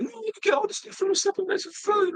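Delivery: mock angry retort in high falsetto; angry student. Vegan student arguing about supplements, high-pitched mock anger. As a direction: squeal the objection as an imitated student. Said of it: "And you're very angry".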